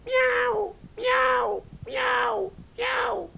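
very high mewing kitten voice. Acted. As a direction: mew like tiny sea cats. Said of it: "les chats marins miaulent".

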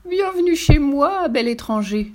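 high, drawn-out flirtatious coo, easing down; cooing yeti damsel. A narrator's reading. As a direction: coo a seductive welcome. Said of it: "roucoula Damoiselle Yeti". (roucouler en demoiselle yéti, voix aiguë)

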